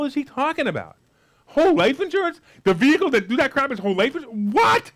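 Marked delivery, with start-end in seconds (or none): 1.4-4.9 rising, louder outraged "What?"; mock disbelief. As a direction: act stunned at the idea.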